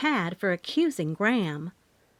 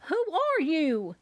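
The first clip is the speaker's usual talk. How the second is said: yelled; 8 semitones higher, slower than usual. A narrator's reading. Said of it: "Mama yelled".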